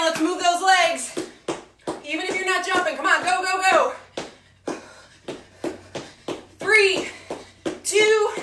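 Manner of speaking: loud, high, pumped-up workout cues; barking gym coach. Acted. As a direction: drive the class through the last seconds. Coach pushing final set.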